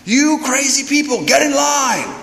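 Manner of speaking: imitated high-pitched scolding; angry priest. Mad priest orders the crowd into line, high angry scolding. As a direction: tell off a crowd in mock exasperation. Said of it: "He's angry".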